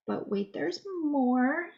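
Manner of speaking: slow, drawn-out TV pitchman tease; infomercial hype. Acted. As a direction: sell it like a late-night ad. Catchphrase in a product demo.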